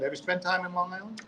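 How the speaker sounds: ordinary delivery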